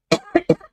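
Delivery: sharp real cough; coughing fit. Real, not acted. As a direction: cough hard, clearing the throat. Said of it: "sorry about the coughing today".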